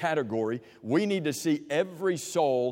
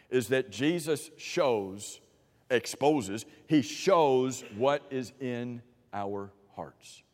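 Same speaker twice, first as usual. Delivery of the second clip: slow emphasis; 4 semitones lower, much slower than usual. Real, not acted. Stressing the key point slowly.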